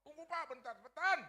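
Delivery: high, loud yell from a distance; far-off driver hollering back; shouted reply. Retold or imitated. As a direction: holler back to wait a moment.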